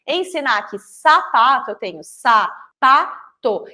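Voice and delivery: "sapato" broken into "sa, pa, to" with a pause between each beat; syllables split. Shown as a demonstration. Teacher splitting "sapato" into syllables for the children, slow and separated. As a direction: clap out "sa", "pa", "to" one at a time. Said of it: "Cada abrir de boca".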